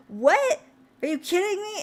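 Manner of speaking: surprised disbelief. Retold or imitated. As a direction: gasp it out as stunned news. Friend learns they're neighbours.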